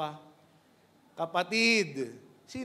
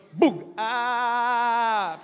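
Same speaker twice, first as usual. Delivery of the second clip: quick thud then loud little ouch; short drop and yelp; 3 semitones higher, 8 dB louder than usual. Shown as a demonstration. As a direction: demonstrate a small second-floor fall. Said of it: "ganito yung tunog".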